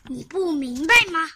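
loud, exasperated shout; 恼火地喊. A narrator's reading. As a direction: yell it at him in disbelief. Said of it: "傲慢先生喊道".

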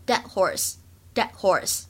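"dead horse" with the final d of "dead" held back; incomplete plosion. Shown as a demonstration. Teacher showing learners the stop, "dead horse" with unreleased d. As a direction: say "dead horse" slowly, barely releasing the d. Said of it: "不完全失去爆破的现象".

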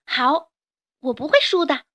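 put-on a child vowing to win; determined child. 孩子下决心. (小孩子坚定地说)

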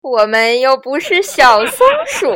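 loud mocking retort with laughter; jeering weasel laugh; roaring laughter. A narrator's reading. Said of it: "轰然大笑".

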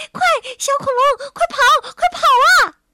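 frantic high yell, rising to a scream; shouting. Acted. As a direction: scream a desperate warning. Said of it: "姐姐跳着脚大喊大叫". (焦急地尖声大喊)

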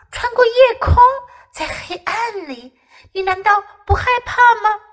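high alarmed cry; frightened exclaim. A narrator's reading. Scared little bear exclaiming at his friend's dream. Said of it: "福子惊呼说".

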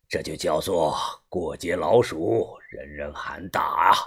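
low, soft, weighty scolding; old gang boss, reproving. Acted. (把头低沉缓慢地训诫)

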